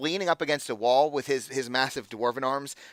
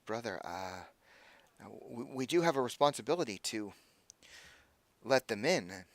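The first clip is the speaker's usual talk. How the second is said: softer, hesitant acolyte voice; timid acolyte; 8 dB quieter than usual. Acted. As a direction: plead gently with the brother. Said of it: "one of them says".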